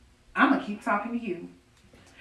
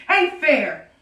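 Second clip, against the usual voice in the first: high and loud, ain't fair flung out; bitter outcry; 5 semitones higher, 7 dB louder than usual. Acted. Widow protests the killer walking over her husband's grave, loud and bitter. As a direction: spit out the unfairness.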